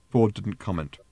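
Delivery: ordinary delivery, nothing marked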